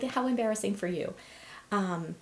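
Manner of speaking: ordinary talk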